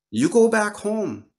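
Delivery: a hint of a kindly grandmother spirit telling the girl to go home; Grandmother Moon voice. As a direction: speak as the moon grandmother comforting a child.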